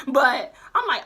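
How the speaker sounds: ordinary talk, nothing marked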